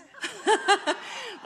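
high burst of laughter; delighted laugh. Real, not acted. Laugh at the headline.

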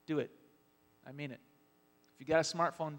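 ordinary talk, nothing marked

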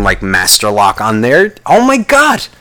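normal talking voice

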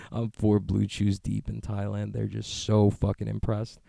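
normal talking voice

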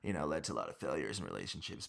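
normal talking voice

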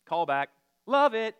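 bursting out loud in delight at the crowd; loud approving call. Real, not acted. Preacher cheers the congregation's call-back, loud and delighted. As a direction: shout back your delight, big and high.

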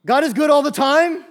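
high, loud rising call. Shown as a demonstration. Preacher calling out to the congregation, loud and high. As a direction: hurl it out to the room. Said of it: "say it like you mean it".

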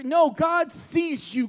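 high, slow, shouted correction; slow shouted insistence; emphatic shout. Real, not acted. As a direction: cut in and insist God sees you. Preacher cuts in emphatically.

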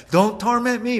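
hammed-up pleading demon; mock demon plea. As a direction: whine it like the demon begging. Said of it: "The demon's like".

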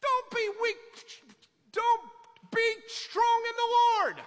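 high, loud fiery exhortation; preaching shout. Real, not acted. Pastor rallies his congregation with a high, loud shout. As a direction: shout the charge high and strong.

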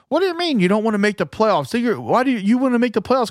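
high, fast mocking voice; mocking Twitter fan. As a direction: sneer at the clueless fan, quickly. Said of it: "I'm arguing with people on Twitter".

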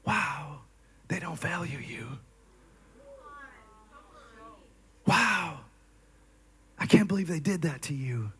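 hushed, tempting whisper; soft, breathy tempter in the ear; flesh whispering. Retold or imitated. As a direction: whisper it close and sly, like temptation. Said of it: "this is the flesh whispering".

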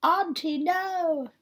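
a hammed-up movie cry; retold cry of no. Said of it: "and she's like".

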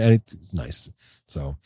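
ordinary delivery

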